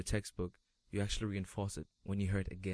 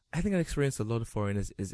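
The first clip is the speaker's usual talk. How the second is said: ordinary talk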